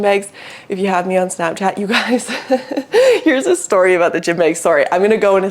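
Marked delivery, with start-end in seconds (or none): 2.3-3.2 short giggling laugh; high giggle. Real, not acted. Laughs about Snapchat story.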